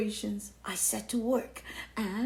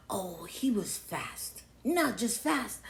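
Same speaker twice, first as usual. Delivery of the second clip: ordinary delivery, nothing marked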